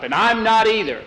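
a man calling out his refusal from the crowd; shouted. Retold or imitated. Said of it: "a couple more shouted up".

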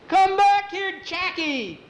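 high, urgent holler; alarmed, high-pitched call to return; calling after him. Acted. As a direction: holler it up at someone flying away. Yelling up at flying boy.

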